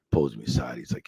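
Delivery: normal talking voice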